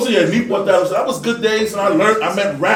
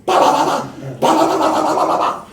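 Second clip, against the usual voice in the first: clipped mock gunfire, partly whispered; imitated gunshots; 9 semitones higher than usual. Recalling the shooting outside, imitated gunfire. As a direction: pop out the shots like gunfire. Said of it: "I'm thinking this bullshit".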